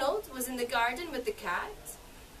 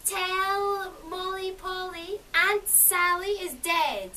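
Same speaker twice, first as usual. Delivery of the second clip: slow, high, eerie cat voice; eerie talking cat; 5 semitones higher, slower than usual. Acted. Storyteller voicing the talking cat to grandfather, slow and eerie. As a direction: draw it out like a ghostly cat.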